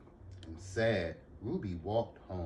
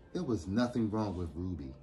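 plain reading voice

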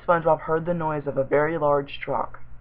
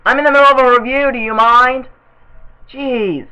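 loud, high, rapid yell at an interruption; annoyed shout; 8 semitones higher, 10 dB louder than usual. Real, not acted. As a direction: shout them off, fast and irritated. Snaps at someone interrupting the review.

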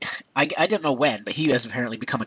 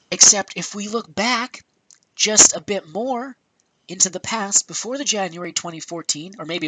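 pointed build-up; 6 semitones higher than usual. Real, not acted. About to catch Iwata out, pointed build-up. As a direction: raise the pitch and draw out each phrase.

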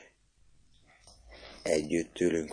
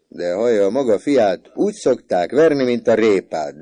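loud, high, gleeful report; animated tattling; 6 semitones higher, 10 dB louder than usual. A narrator's reading. Mother telling father about son's beatings, animated tattling. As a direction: tell it loudly as a huge joke.

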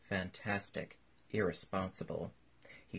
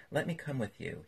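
usual speaking voice

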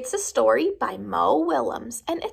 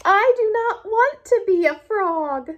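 scared protest; 9 semitones higher than usual. Acted. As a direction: beg not to be a frog. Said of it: "Gerald is scared".

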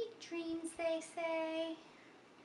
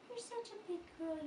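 put-on "You're such a big girl" lifted much higher, sing-song; higher praising repeat; 7 semitones higher than usual. Shown as a demonstration. Mother praising her baby girl while changing her, higher sing-song. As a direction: praise her again up high.

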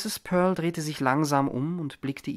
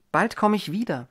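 calling out; 7 semitones higher, 4 dB louder than usual. A narrator's reading. Continuing her farewell call.

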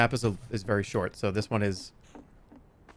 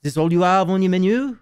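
high-pitched French accent with rising end; 4 semitones higher, 4 dB louder than usual. Shown as a demonstration. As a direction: ask it in an exaggerated French accent. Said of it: "say in a French accent".

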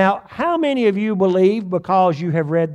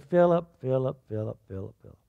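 soft, fading repeated name, dropping quieter and lower; a lightly voiced Jesus, sighing out Philip's name; sorrowful Jesus; 5 semitones lower, 6 dB quieter, faster than usual. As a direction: call his name gently, each time fainter. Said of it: "He probably said it just like that".

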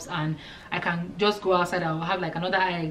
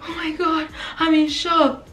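mock shocked patient; 9 semitones higher, slower than usual. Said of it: "she was like".

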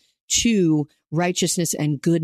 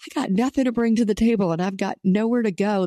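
higher, worn-out voice of someone with nothing left; weary and spent; 5 semitones higher than usual. Retold or imitated. A struggling believer at the end of their rope, higher and weary. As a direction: sound like you've hit the end of your rope. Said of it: "who's like".

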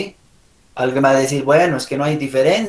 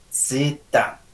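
usual speaking voice

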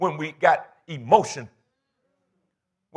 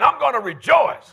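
louder, higher rallying declaration; emphatic repeat; 7 semitones higher, 5 dB louder than usual. Shown as a demonstration. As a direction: raise it up for the congregation to echo. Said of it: "Say it again".

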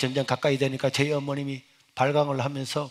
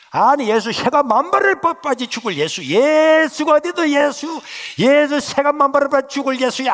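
higher, louder, slow hurled curses; cursing rant; 10 semitones higher, 8 dB louder than usual. Retold or imitated. Mother cursing Jesus in rage, loud slow curses.

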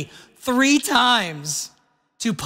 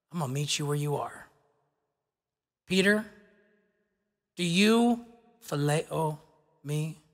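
softer, low, intimate voice of Jesus; gently meet him where he is; tender quiet Jesus; 4 semitones lower, 6 dB quieter than usual. Acted. Jesus gently asks Peter again.

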